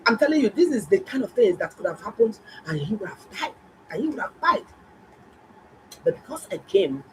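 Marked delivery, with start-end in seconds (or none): 2.7-3.5 hushed, dropped into a whisper. Real, not acted. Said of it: "whispered repetition". 3.9-4.6 repeated higher, with weight on died; emphatic repeat. Real, not acted. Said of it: "repeated louder for emphasis".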